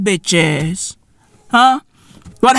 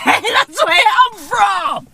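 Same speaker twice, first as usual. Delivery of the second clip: laughing shout; 10 semitones higher, slower than usual. Acted. Repeats hometown line louder, laughing.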